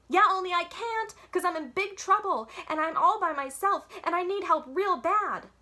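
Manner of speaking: high, loud, desperate plea; pleading. A narrator's reading. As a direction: beg for help, all in a rush. Girl pleading with operator.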